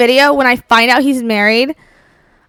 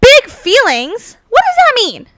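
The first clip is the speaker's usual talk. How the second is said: much higher, stretched mock disbelief; incredulous echo; 10 semitones higher, 3 dB louder than usual. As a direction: squeak it out, offended.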